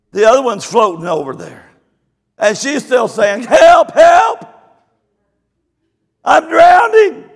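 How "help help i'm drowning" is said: high, loud panicked yelling; frantic cry for help. Retold or imitated. Ladies flailing in the river, shrill loud cries for help. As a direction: scream for help like you're drowning. Woman crying she is drowning.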